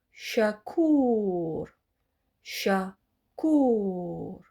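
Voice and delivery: shakour with a long falling ou; model word. Shown as a demonstration. Teacher modelling long-vowel word for students. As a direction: lengthen the ou of shakour as it falls. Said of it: "Répétez après moi".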